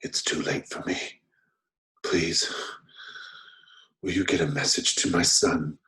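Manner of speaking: breath-starved whisper with long pauses. Acted. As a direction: plead in a failing whisper. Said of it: "he lowers his voice as much as he's able, and he mutters".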